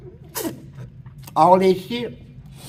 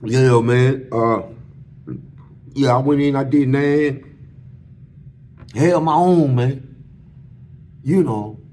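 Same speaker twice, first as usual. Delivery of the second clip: low, louder mock tough-guy brag; fronting; 5 semitones lower, 3 dB louder than usual. Ex-inmate boasts to friends about his time, fronting. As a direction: put up a front like a hardened ex-con. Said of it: "old front stuff".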